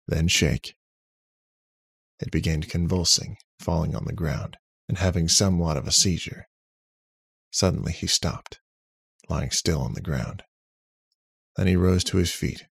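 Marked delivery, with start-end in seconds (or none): none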